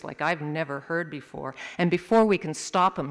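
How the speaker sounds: ordinary delivery, nothing marked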